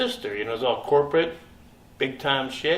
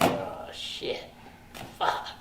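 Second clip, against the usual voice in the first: dismayed mutter; 5 semitones lower, 8 dB quieter, much slower than usual. Retold or imitated. Dismayed seeing ponytailed director.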